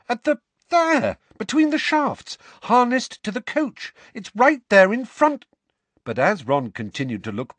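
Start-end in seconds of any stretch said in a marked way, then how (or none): 0.1-5.5 much higher, louder urgent insistence; desperate to make him see; insistent. Acted.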